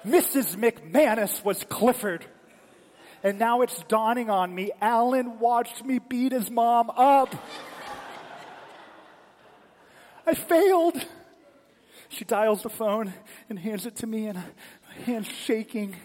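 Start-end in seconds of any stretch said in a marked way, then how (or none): 0.0-11.0 loud, high disbelief building to a wail; horrified realization. Retold or imitated. Boy realizes he beat Alan's mom up, loud mounting horror. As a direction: play a kid's dawning horror, louder and higher to the end. Acted dawning horror at his mistake.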